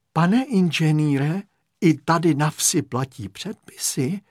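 usual speaking voice

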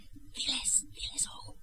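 very soft, voiceless whisper; secret whisper. Acted. Aunt cues the hidden boy to come out, whispering. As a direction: whisper a covert order.

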